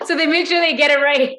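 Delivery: high, loud laughing talk. Real, not acted. Friend reacting to a strict spelling punishment, laughing. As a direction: burst out laughing while talking. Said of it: "I can't stop laughing now".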